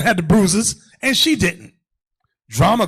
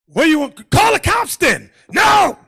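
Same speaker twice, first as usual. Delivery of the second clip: mock argument; 8 semitones higher, 6 dB louder than usual. Preacher argues with sister.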